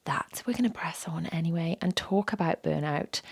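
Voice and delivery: real sore throat, fading to a breathy whisper; voice giving out. Real, not acted. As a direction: voice cracking away to a whisper. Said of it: "I can't, um, help it at the moment".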